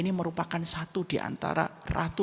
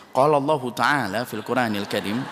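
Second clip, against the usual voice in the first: mock preacher; 7 dB louder than usual. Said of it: "nggak mungkin saya ngomong gitu".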